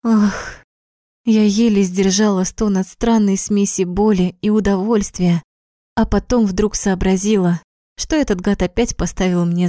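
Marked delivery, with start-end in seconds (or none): none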